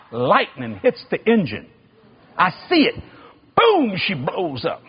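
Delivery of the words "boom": high sudden boom; mock explosion; imitated explosion. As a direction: pop it out like a blast. Engine explodes in story.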